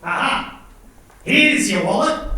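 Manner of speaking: mock Australian reply, rising high and loud; Aussie accent. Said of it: "And an Aussie will reply".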